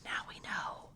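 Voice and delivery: conspiratorial whisper. Real, not acted. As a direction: hush it like a secret. Conspiratorial aside about the king.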